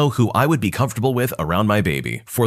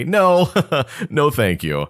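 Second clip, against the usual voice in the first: nervous laugh; 5 semitones higher, slower than usual. Retold or imitated. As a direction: laugh it off awkwardly. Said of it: "I laugh nervously".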